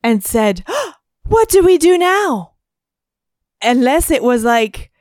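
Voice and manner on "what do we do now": high, breathless mock alarm; mock gasping damsel. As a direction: gasp and whine it up high. Gasp then mocking quote.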